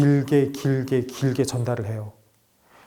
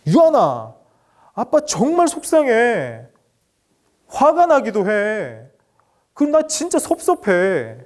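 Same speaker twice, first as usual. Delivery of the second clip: higher, slow, hurt pleading voice; hurt father; 7 semitones higher, 3 dB louder, slower than usual. Shown as a demonstration. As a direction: share the hurt without blaming the child. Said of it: "이렇게 이야기하는 거죠". (아이에게 서운함을 높고 느리게 털어놓는 아빠)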